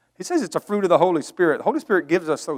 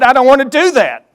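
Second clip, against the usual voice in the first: much higher and louder retold shout; raised voice; 9 semitones higher, 10 dB louder than usual. Son shouting at his father over the harvest, loud. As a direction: yell it back at him. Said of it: "and I raise my voice".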